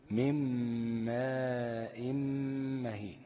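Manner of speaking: "من ماء مهين" بغنة ممدودة جدًا على الميم; مدّ الميم المشددة في "من ماء" ببطء شديد; drawn-out ghunna on mim. Shown as a demonstration. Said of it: "مثل". (إدغام "من ماء" بغنة طويلة بطيئة)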